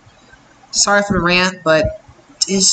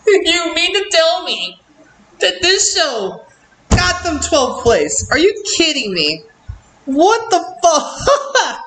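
incredulous outrage; 8 semitones higher than usual. Real, not acted. Reviewer reacting to a twelfth place result, incredulous outrage.

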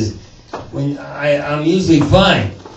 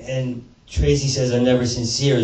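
ordinary delivery

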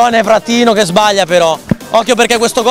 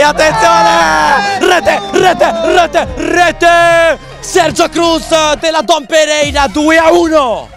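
breathless italian goal commentary; 8 semitones higher, much slower than usual. Real, not acted. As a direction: scream the goal like a stadium commentator. Goal call, commentator shouting. (telecronaca urlata del gol)

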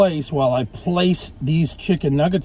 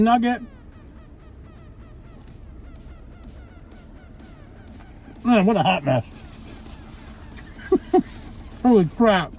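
talking while eating; speaking between bites; mouth full chewing; 13 semitones higher, 5 dB quieter than usual. Real, not acted. Seen 3: Bites burger at 0.6–1.5s, then chews while talking, wipes mouth.

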